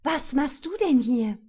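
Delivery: high, loud, startled question; horrified surprise. A narrator's reading. Daughter confronts father who turned up unannounced, shocked and appalled. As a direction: demand it in shock and dismay. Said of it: "in einer Mischung aus Überraschung und Entsetzen".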